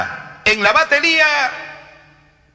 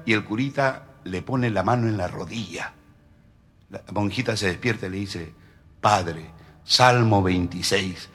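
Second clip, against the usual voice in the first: much lower, much softer murmur; much lower, softer sly hint; hushed low voice; 11 semitones lower, 7 dB quieter than usual. Acted. Nun's voice, very low.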